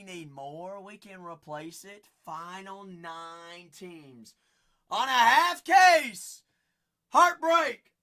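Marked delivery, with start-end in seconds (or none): 4.9-7.9 very loud, high sales holler; hype shout. Real, not acted. Host selling spots on a half case, shouting. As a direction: blast out each short phrase. Host pitches the break to viewers.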